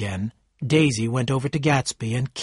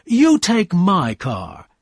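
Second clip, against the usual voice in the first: very slow, loud, higher command; 6 semitones higher, 5 dB louder, much slower than usual. A narrator's reading. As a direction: draw it out loudly as an order. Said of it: "Tom said in a loud voice".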